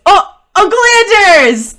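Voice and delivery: put-on high, loud little-boy shout; excited child. Boy reopening door to see uncle, loud excited squeal. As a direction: cry it out high and delighted.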